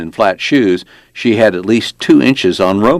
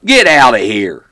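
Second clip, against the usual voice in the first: loud, raised-pitch order to leave; yelling back; 5 semitones higher, 5 dB louder than usual. A narrator's reading. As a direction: holler to drive them off. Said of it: "turned around and yelled back".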